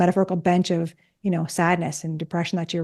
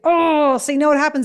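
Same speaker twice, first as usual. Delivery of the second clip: mock dismay; 7 semitones higher than usual. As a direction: tease with an exaggerated realization. Mother's playful reaction to undone chore.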